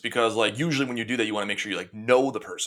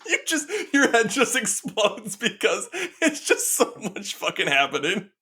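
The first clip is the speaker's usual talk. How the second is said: slow, higher, words through laughter; laughing; 11 semitones higher than usual. Real, not acted. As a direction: let the laughter carry the words. Still laughing, describing reaction.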